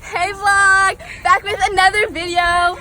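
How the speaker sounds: loud, high YouTuber greeting; vlogger intro. Pretend. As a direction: greet your fans like a YouTuber. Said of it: "say hey to the vlog".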